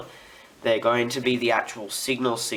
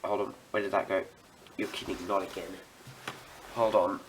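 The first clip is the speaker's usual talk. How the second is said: normal talking voice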